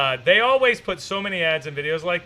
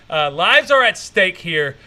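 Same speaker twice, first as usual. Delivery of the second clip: slow, louder Die Hard movie line; mock action hero; 6 dB louder, slower than usual. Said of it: "Die hard with a vengeance".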